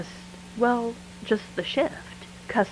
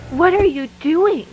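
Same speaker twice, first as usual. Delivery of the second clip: frightened demand; 7 semitones higher, 7 dB louder than usual. Acted. Confronting Gordon at her car.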